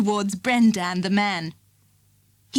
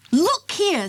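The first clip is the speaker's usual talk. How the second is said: firm; 9 semitones higher than usual. A narrator's reading. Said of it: "very firmly".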